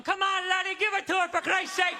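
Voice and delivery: high Irish brogue, egging him on; hammed-up Irish accent cheer from the ancestors. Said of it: "like, going".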